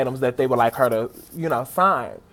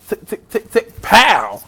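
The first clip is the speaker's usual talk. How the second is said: imitated drop sounds; 4 semitones higher, 5 dB louder, slower than usual. Fan acting out the diva drop, ticks and bang.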